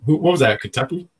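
ordinary talk, nothing marked

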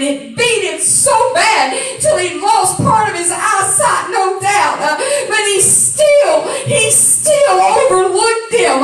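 loud, high, chanted preaching; full-force preaching. Acted. Preacher rising into a chanted climax about Jesus' silence. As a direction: whoop it out like a climax.

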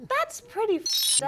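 very high put-on child voice; blunt kid. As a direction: pass judgment in a squeaky kid voice. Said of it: "expecting the kids to be like".

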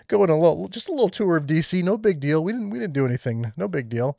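high, breezy mock innocence; mock innocent rioter. Host mocking a rioter's excuse, high and breezy. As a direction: play it off like nothing happened.

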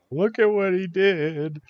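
put-on tattling kid. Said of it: "run off to mom".

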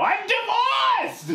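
extremely high, stretched-out screech; yelling divorced mother. Acted. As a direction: shriek it out as a proud excuse. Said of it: "Mom, you only yell now".

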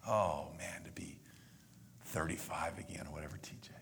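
breathy wistful whisper; almost to himself, longing. Real, not acted. Wistful aside, voice drops.

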